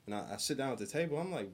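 normal talking voice

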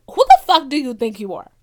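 loud, indignant outburst, retold; retold snap of anger. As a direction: act out losing it, punching the words out loudly. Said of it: "Something inside me fucking snapped".

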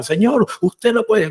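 mock stern nurse stretching out Señor; imitating the nurse. Nurse warning the son not to give the pill, mock stern and slow. Nurse's reply in retold fight.

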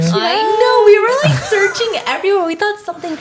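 high, loud excited recall. Real, not acted. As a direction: burst out excitedly. Remembering the smelly car.

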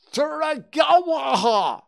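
shrill garbled scream at full lungs; screaming gibberish. Acted. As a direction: scream it so no one can understand. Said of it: "no one could really tell what she was saying".

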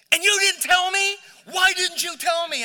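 high, loud cry of disbelief; the wife blurting out in shock; shocked outburst. Retold or imitated. Wife bursts out at husband.

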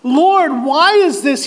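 high, loud anguished question; crying out to God. Acted. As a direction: cry out in bewildered anguish. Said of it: "he cried".

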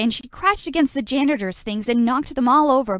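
ordinary delivery

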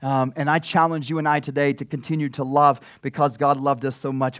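ordinary talk, nothing marked

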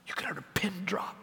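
whispered aside into a silent room; very soft, breath-only hush. Real, not acted. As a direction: drop to a whisper, barely voiced.

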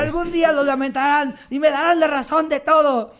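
berrinche agudo y muy fuerte; grito otaku exagerado. Acted. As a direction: grita como otaku incomprendida, con drama exagerado. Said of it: "empezó a gritar". (chillido dramático de anime)

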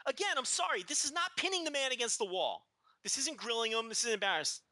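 high-pitched scolding rant; worked-up, disbelieving criticism; exasperated indignation. Real, not acted. Ranting at the weak interviewer.

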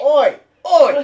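loud, high mock-Cockney "oi"; Cockney accent. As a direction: holler like a bad Cockney. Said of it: "It's a shitty Cockney".

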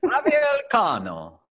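high, drawn-out Spanish accent. Shown as a demonstration. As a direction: announce it in a showy, slow Spanish accent. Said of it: "do this in my Spanish accent".

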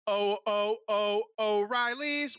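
high, loud jolly Santa ho-ho. Pretend. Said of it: "which is how Santa sounds".

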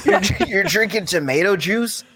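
mock disbelief through laughter; laughing mock outrage. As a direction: act appalled while cracking up.

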